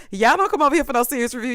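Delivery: ordinary talk, nothing marked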